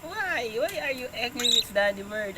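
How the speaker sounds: ordinary talk, nothing marked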